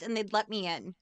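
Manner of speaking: ordinary talk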